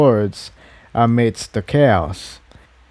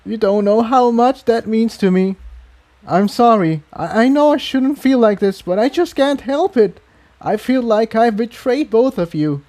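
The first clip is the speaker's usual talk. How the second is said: much higher, emotional gratitude; Incendia's voice; 10 semitones higher than usual. A narrator's reading. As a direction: pitch way up, moved and apologetic. Incendia, tearful and grateful.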